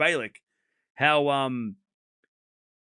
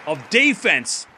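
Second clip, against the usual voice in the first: play-by-play commentary; 7 semitones higher, 7 dB louder than usual. Real, not acted.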